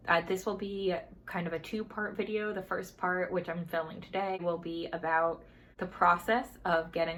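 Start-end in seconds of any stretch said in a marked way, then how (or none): none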